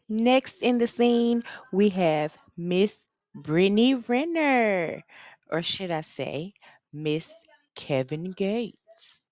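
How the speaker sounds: slow, grand announcer intro; announcer build-up. Acted. Host introducing Brittany to viewers, slow drawn-out announcer voice. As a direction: roll it out like a show host. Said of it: "host announcing next cast member".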